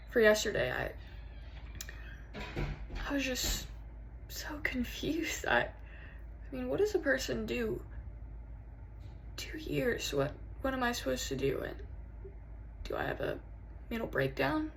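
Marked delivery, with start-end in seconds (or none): 3.1-10.1 hushed, halting and lost; barely voiced bewilderment; quiet confusion. Confused lover confiding in partner. 10.1-11.6 soft, lost question; hushed and helpless; subdued helplessness. Acted. First, subdued take of question. 11.7-14.7 hushed, halting and lost; barely voiced bewilderment; quiet confusion. Confused lover confiding in partner.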